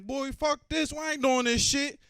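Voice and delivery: loud, high, slow panic at the open door; panicked refusal. Retold or imitated. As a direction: balk in terror before the jump. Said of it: "I'm like".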